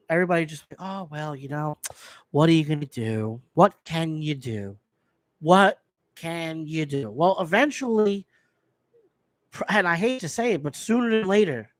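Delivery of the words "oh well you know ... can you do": low, slowing, helpless shrug; mock resignation. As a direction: imitate people giving up, dragging it out. Mimicking everybody's resigned shrug.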